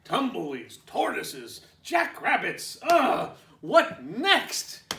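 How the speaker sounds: high, loud fed-up grumble; cranky cactus complaining; exasperated rant. Acted. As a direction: list them off in disgust. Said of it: "said Hank".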